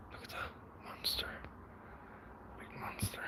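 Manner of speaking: hushed whisper, barely voiced. Real, not acted. Whispering to viewers while showing off the vegetable garden. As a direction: breathe the words like a secret.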